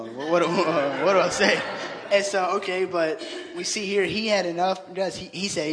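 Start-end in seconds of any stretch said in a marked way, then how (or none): 0.0-1.6 put-on laughing nervous teen. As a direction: play the flustered boy, giggling. Mimicking the nervous teen at the door.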